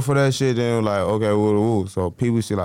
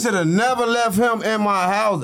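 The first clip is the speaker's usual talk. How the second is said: NEVER pushed up high in frustration; regretful emphasis; 8 semitones higher than usual. Retold or imitated. Telling the host about Ruger, exasperated emphasis. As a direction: hammer the regret.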